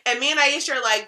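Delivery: ordinary delivery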